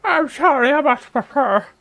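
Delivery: high, loud parroting of the apology; high mock echo. Streamer parrots game apology.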